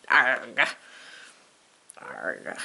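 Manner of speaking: low, fading pirate growl; pirate grunt. Acted. Pirate grunts after scolding.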